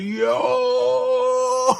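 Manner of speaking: long, high, drawn-out whoop; mock crowd uproar. Guests reacting to an awkward hookup reveal, mock shocked uproar. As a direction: hold it like a scandalised crowd.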